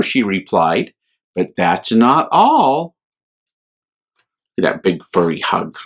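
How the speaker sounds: gentle, lively bedtime reading voice; children's story reading. A narrator's reading. A priest reading a picture book to children, gently. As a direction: read it to little ones with wonder.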